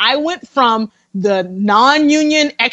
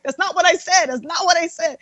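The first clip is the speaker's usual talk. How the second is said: high, softer mock exasperation; exasperated correction; 7 semitones higher, 4 dB quieter than usual. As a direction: correct mom in a high, pleading voice. Correcting mom who misunderstands.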